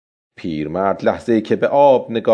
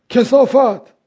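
high, loud cursing shout; loud exclamation; 5 dB louder, slower than usual. A narrator's reading. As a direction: cry out the insult at a much higher pitch. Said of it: "پیرمرد با صدای بلند گفت". (فریاد بلند و زیرِ پیرمرد)

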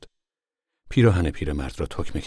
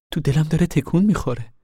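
put-on a much higher, louder young woman's voice; a high, eager young pregnant girl asking her mother; 11 semitones higher, 5 dB louder than usual. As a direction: voice the anxious young daughter, pitched up. Rose of Sharon feels baby move.

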